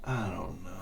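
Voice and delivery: low, soft, giving-up mumble; quiet defeated shrug. Real, not acted. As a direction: trail off in helpless defeat. Defeated repeated aside.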